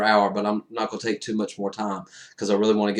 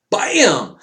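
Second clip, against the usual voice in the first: loud exclamation; 7 semitones higher, 6 dB louder than usual. Real, not acted. Punctuating sacrifice moment.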